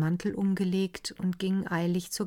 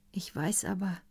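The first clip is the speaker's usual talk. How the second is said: soft, fading to a whisper; quiet whispered confession; hushed confession; 6 dB quieter than usual. Acted. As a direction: confess it barely aloud to the dead.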